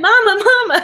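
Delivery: put-on high, loud little-boy call with a laugh; laughing as she voices the little boy; little boy calling mama. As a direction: imitate a small child calling for his mother. Child voiced calling his mother.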